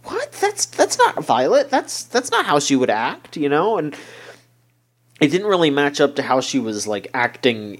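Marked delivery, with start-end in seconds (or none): 0.0-3.4 retold disbelief, pitch jumping up; incredulous reaction. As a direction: replay a baffled objection, stumbling on "that's". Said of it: "I was just like".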